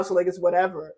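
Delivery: ordinary delivery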